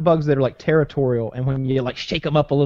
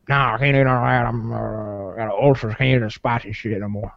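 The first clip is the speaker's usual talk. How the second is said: lower, mumbled imitation of a friend; friend's mumbled voice; 4 semitones lower than usual. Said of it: "Which translates to".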